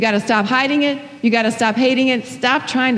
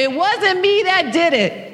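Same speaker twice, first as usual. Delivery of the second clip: higher, slow, awed testimony; testimony cry; 7 semitones higher, slower than usual. Retold or imitated. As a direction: proclaim it slowly, lifted up. Preacher voicing a believer's testimony.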